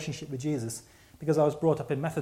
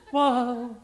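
high, louder mock whoa; stupid voice; 9 semitones higher, 5 dB louder than usual. Lady interrupting the man of God, stupid high voice. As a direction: mimic her silly whoa, high and intrusive. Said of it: "putting that stupid voice on".

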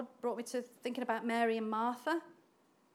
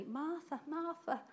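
softer, tender Martha twice; gentle, calming; 3 semitones higher, 8 dB quieter, slower than usual. Acted. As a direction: soothe her by name. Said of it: "expresses how he says it to her".

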